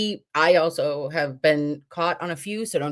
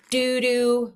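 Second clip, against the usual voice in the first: usual speaking voice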